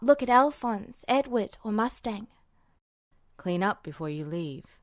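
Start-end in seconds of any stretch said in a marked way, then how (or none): 3.4-4.8 much lower and softer, near a murmur. A narrator's reading. Mustang telling Edward to tidy up, softly. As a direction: say it quietly in passing on the way out. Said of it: "Mustang said softly".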